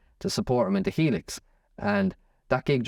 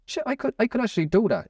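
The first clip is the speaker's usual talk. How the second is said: much higher, retold burst of realization; excited realization; 11 semitones higher than usual. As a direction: relive the moment it clicked, pitch jumping up. Retelling a sudden inspiring realization.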